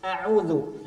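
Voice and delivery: ordinary talk, nothing marked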